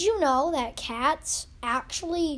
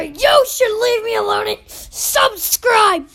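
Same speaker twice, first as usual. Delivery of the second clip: high, loud crying shout; shouted plea; 8 semitones higher, 11 dB louder than usual. Real, not acted. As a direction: yell the plea to subscribe.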